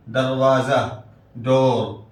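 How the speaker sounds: ordinary talk, nothing marked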